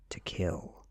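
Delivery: plain reading voice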